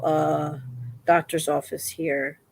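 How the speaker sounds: ordinary talk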